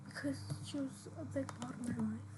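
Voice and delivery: quiet, fast, tearful shaky sigh; real tears caught in the voice. Real, not acted. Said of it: "I'm gonna wipe my tears".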